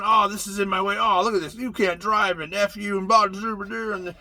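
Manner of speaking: hammed-up grumbling, pitch and volume rising; mock angry shopper. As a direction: ham up an impatient shopper griping at everything. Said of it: "everyone's going around".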